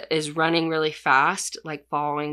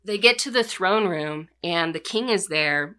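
normal talking voice

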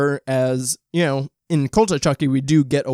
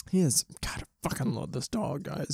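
soft and hushed; 10 dB quieter than usual. Real, not acted. Affection while the dog eats nearby.

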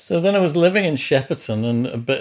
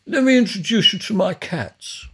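imitating Chris; 8 semitones higher, 3 dB louder than usual. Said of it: "And he said".

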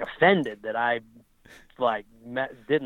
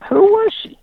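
high, loud, disbelieving question; retold Frankel exchange, pressing him: incredulous; incredulous repeat; 9 dB louder than usual. Repeating his question, pitch jump.

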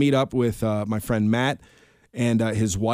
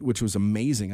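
ordinary delivery

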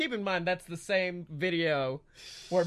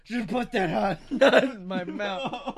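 slow, laughing silly voice; hammy, giggling demand; 3 dB louder, slower than usual. Acted. Comic voice, laughing.

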